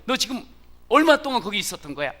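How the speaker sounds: high, loud, fast interrogation; mother grilling her son, loud, high and fast; sharp mother. Retold or imitated. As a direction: fire the question at him. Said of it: "딱 말하죠".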